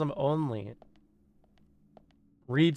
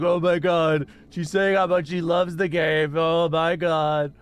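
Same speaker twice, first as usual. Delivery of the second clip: louder mock-excited gasp; imitating Kafki; 5 dB louder than usual. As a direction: gush it like a shocked friend. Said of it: "Kafki's always like".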